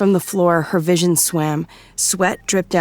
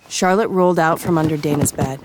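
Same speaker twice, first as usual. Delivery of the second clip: ordinary delivery, nothing marked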